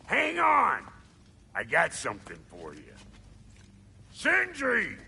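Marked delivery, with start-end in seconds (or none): 0.1-0.8 high, loud holler; urgent raised call. Acted. Calling after departing companion. 4.1-5.0 high, loud holler of a name; loud summons; calling out. Acted. Calling for companion.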